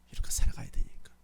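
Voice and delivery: breathy whispered repeat of the line. Shown as a demonstration. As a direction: say it again in a hush to the congregation. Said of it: "same line repeated". (속삭이듯 다시)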